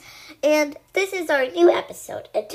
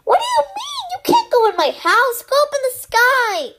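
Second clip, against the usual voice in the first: high, loud outraged shouting; full-voiced angry dismissal; indignant yell; 11 semitones higher, 10 dB louder than usual. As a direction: yell it in disbelief, pitched way up. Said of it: "I yelled".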